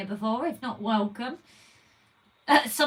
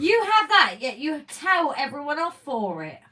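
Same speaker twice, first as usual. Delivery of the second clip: mock accusation; 6 semitones higher, 6 dB louder than usual.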